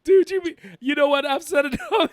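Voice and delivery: high-pitched, words squeezed between laughs; real laughing fit; cracking up. Real, not acted. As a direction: give up talking through helpless laughter. Too overcome with laughter to continue.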